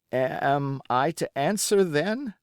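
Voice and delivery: high, trembling question; frightened boy asking; tremulous voice. A narrator's reading. Captured boy to pirate Silver, tremulous and scared. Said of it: "I asked with a very tremulous voice".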